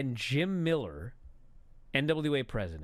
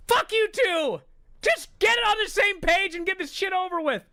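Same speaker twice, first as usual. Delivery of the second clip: high, very loud retold outburst; exasperated shout; 7 dB louder than usual. Fed-up viewer yelling at two botching wrestlers, exasperated high shout. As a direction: yell at them, completely fed up.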